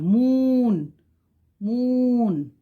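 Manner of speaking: moon with a long stretched oo. Shown as a demonstration. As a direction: draw out the oo in moon. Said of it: "ma'am is stretching the oo sound".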